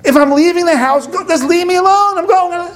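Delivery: high, loud exasperated outburst, acting out the husband; going totally crazy. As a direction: blow up at her in an imitated screaming rant. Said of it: "he goes totally crazy".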